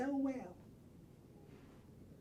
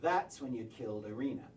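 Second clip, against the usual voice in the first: ordinary delivery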